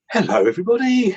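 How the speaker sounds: high, loud cheerful hello to the room; beaming hello as he walks in; joyful greeting. Retold or imitated. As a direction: call out hello bursting with delight. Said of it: "and going".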